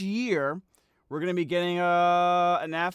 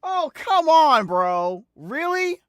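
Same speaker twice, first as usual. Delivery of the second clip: loud, high, drawn-out groan of protest; exasperated shout; 8 semitones higher, 11 dB louder, much slower than usual. Real, not acted. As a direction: yell it out in fed-up disbelief. Outburst at shirt joke.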